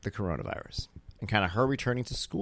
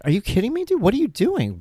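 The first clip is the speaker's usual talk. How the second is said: high, loud mock scolding; worked-up, incredulous retold outburst; retold exasperation; 11 semitones higher, 5 dB louder than usual. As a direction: replay a dad losing patience. Exasperated father after stopping recorder.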